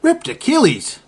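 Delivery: normal talking voice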